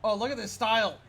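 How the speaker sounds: normal talking voice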